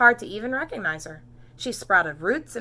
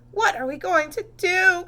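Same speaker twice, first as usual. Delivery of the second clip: high, loud and desperate; distraught cry; 11 semitones higher, 9 dB louder than usual. A narrator's reading. Missus Cream cries out in despair over Camilla. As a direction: plead in despair. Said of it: "cried Missus Cream".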